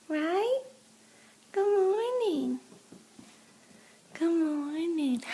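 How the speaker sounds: high sing-song dog talk. Real, not acted. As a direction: coo at the dog. Greeting the dog.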